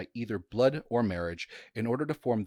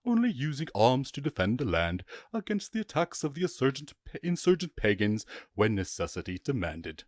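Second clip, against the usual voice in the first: lifted, formal reading of a medieval text; mild ceremonial reading voice; reading old chronicle; 3 semitones higher than usual. Acted. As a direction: read it like a solemn chronicle quote. Reads out medieval chronicle quote.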